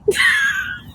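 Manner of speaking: a burst of high laughter. Real, not acted. Laughs after mock despair.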